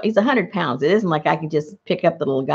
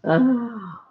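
usual speaking voice

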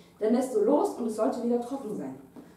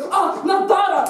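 higher, louder cry; startled shout; 7 semitones higher, 7 dB louder than usual. Retold or imitated. Water floods her goggles underwater, startled loud cry. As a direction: cry out as salt water hits.

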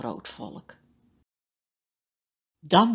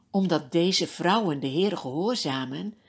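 plain reading voice

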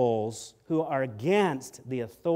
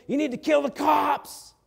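a higher, loud mock rebel shout; 10 semitones higher, 5 dB louder than usual. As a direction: bark it like an agitator at a rally. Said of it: "they're out there saying".

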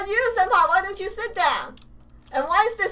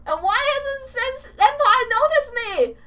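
usual speaking voice

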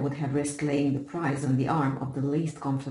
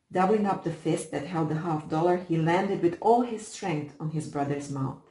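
ordinary delivery

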